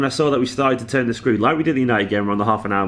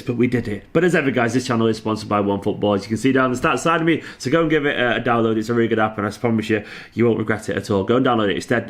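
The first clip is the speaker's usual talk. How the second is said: ordinary delivery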